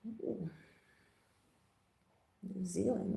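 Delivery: ordinary delivery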